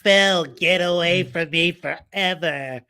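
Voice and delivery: slow whiny imitation; drawn-out mock whine. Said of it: "That whiny little voice".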